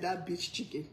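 "That bitch chicken" said softly, teasing; hushed mock quote. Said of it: "She said".

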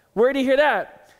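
loud, high mock demand; imitated accusing question. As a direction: act out a wife's startled reproach. Wife's accusing question, retold.

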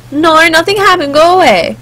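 lightly voiced upset teen; upset daughter. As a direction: send dad away, mildly upset. Upset daughter sends dad away.

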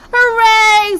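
very high, loud, long cheer; delighted squeal. Acted. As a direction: squeal it in gleeful triumph. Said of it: "squealed Halfdan".

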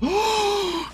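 sharp excited intake of breath; delighted gasp. Real, not acted. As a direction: gasp at a lovely idea. Delighted idea for mouse's name.

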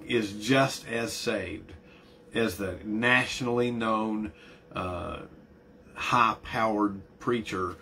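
slow emphasis. Real, not acted.

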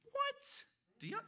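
high, soft squeak of surprise; mock disbelief. Preacher reacting to the elder son's anger, mock disbelief. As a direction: react with a tiny high-pitched double take.

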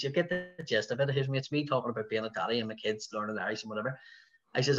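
ordinary talk

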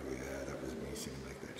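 low, hushed near-whisper; sheepish confession. Retold or imitated. Student confessing to the secretary about his singing, sheepish. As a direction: own up under your breath.

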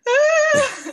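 high, loud burst of laughing relief; retold scream of elated relief coming off the mat; joyful scream. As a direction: let out an overjoyed shriek that breaks into a laugh. Screaming relief after winning fight.

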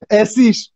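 "اسیش" ending in a sh sound; host's "Essig" pronunciation. Shown as a demonstration. Introducing vinegar in German, "اسیش" ending in sh. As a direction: say "اسیش" with final g as sh.

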